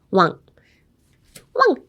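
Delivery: "Want" cut to a woof-like word with no T; dog-bark want. Shown as a demonstration. Teacher likening the word to a dog, bark-like. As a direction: say "Want" like a dog's bark, T dropped. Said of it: "So pretend you're a dog".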